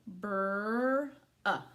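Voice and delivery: "brr" held long, then a separate "uh"; sounding out. Shown as a demonstration. Teacher doing first three sounds, sounded out. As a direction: stretch "brr", pause, add short "uh". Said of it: "do the first three sounds".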